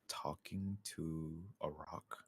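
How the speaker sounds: hushed, slow whisper. Pretend. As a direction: whisper it to your party members. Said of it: "I'm whispering this".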